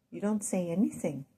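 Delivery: ordinary talk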